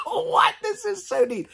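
very high laughing squeal of joy; delighted laughing squeal. Real, not acted. Finding the tiny Chico piano, squealing with laughter. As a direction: laugh out the words, thrilled.

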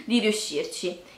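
ordinary delivery

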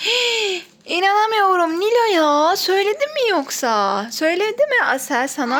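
high gasping surprise; surprised gasp. Real, not acted. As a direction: gasp like she's caught them.

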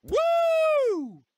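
very high, loud hype yell; wild woo. Acted. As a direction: let out a huge ringing woo. Wrestler hype cry.

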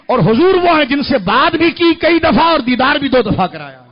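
loud, drawn-out, emphatic proclamation; preaching at full force. Real, not acted. Preacher proclaiming the vision to the crowd, full force. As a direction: build to a thundering climax.

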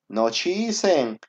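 ordinary talk

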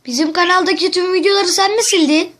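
yüksek sesle telaşlı oyunculuk; korkmuş gibi yap, sesini yükselt; panik numarası. Acted. Telefon şakası, panik numarası. (arkadaşına kanalı silindi diye panikle sesleniyor)

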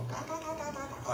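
high, soft mock chatter of a chattering parrot. Father imitating his chattering little daughter, high soft mock chatter. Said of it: "ella hablaba como perico".

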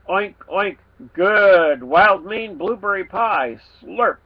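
pig voice, slowing on the pie; grunting pig. Acted. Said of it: "he grunted".

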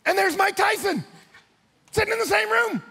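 very high, loud shout; astonished high-pitched exclamation; excited reveal. Real, not acted. Surprise reveal in the story.

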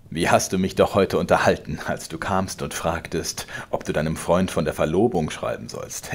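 laut drohender alter Vater, höher und lauter; zorniger Vater. Acted. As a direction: schleudere ihm die Worte herrisch entgegen. Vater höhnt. (der Vater droht dem Sohn, laut und herrisch)

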